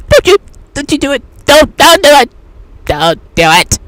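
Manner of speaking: loud, fast, high don't do it; urgent warning. Retold or imitated. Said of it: "the tingling sensation telling you".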